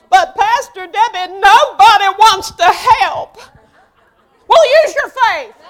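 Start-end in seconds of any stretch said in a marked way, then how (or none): 0.1-3.4 whiny complaint. Retold or imitated. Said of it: "mimicking complaining worker".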